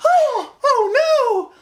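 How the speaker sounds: very high, slow, laughing mock panic; overdramatic Pearl. Fan mocks Pearl panicking over the book, very high laughing shriek. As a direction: shriek it like Pearl melting down theatrically.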